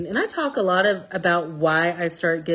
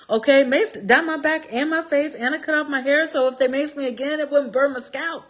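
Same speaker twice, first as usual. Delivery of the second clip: higher, indignant retelling; heated; 7 semitones higher than usual. Real, not acted. Telling viewers about being maced, indignant and higher. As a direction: vent about the injustice.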